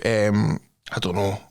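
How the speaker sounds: ordinary talk, nothing marked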